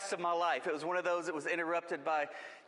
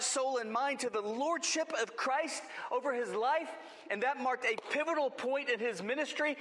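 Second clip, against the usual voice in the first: preaching; 5 semitones higher than usual. Acted. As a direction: preach it with rising fervour. Preaching builds to a high pitch.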